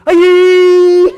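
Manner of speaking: piercing, very high, long-held "ayee"; Swami's cry of "Aye". Retold or imitated. Devotee imitating Swami's reaction to the band's first note, shrieked cry. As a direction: let out a loud squealed "Aye". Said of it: "And Swami said".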